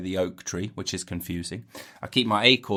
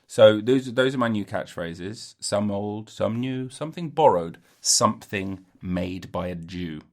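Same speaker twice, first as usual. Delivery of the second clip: ordinary talk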